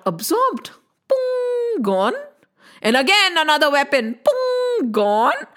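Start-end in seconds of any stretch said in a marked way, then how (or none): 1.1-1.8 high sharp pung noise; pung sound effect. Acted. Weapon hitting the shaft. 4.2-4.9 high sharp pung noise; pung sound effect. Acted. Weapon hitting the shaft.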